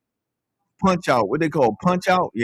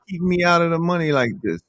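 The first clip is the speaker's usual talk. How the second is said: ordinary talk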